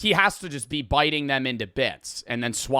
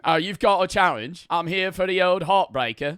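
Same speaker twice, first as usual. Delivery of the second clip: put-on a light, higher, half-hearted Aussie accent; mock Aussie YouTuber voice; 5 semitones higher than usual. YouTuber imitates eater ordering challenge in a rough Aussie accent. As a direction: ham up the eater's challenge line, Aussie-style. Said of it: "I don't know how to do his accent".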